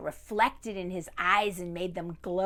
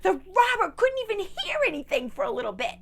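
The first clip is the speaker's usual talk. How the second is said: high, loud breathless narration; wild excited storytelling; 13 semitones higher, 10 dB louder than usual. A narrator's reading. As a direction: race through the panic. Frantic climax of story.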